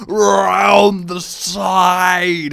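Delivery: loud, very slow drawn-out shout; exclaiming. Retold or imitated. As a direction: stretch it out loudly through the glass. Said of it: "really exclaiming it".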